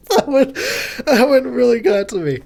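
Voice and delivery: high and loud, still laughing. Real, not acted. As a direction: talk through leftover laughs.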